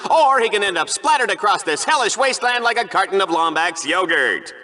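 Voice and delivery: usual speaking voice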